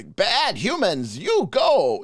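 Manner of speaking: halting goblin grunts, then slow, high blurts; goblin in broken Common. Acted. Game master voicing goblins for the party, halting broken-Common goblin voice. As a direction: play a goblin struggling through bad Common. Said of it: "bad Common, and they say something like".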